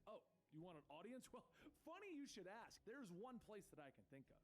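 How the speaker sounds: lightly voiced helpful informant; Barbara. A narrator's reading. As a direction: perk up with an idea.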